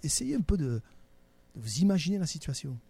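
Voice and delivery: ordinary talk, nothing marked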